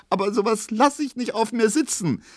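high-pitched tearful defiance; sobbing outcry. A narrator's reading. Pauline won't put up with the slander, sobbing. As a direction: cry out indignantly through sobs. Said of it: "rief jetzt Pauline schluchzend".

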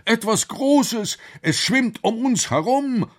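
high, drawn-out alarm; startled professor, high-voiced; alarmed call. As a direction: cry a warning with the pitch way up. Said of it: "rief der Professor".

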